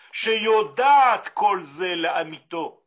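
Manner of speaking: usual speaking voice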